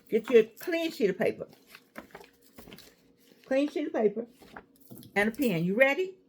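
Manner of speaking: ordinary delivery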